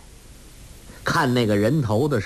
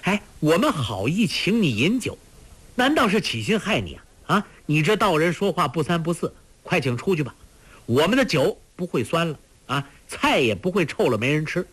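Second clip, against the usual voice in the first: higher, fast, fretful protest; worked-up, impatient scolding in a raised pitch; irritated outburst; 7 semitones higher, faster than usual. A narrator's reading. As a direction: lose patience and tell the guest off. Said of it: "听了更是焦躁，发作起来".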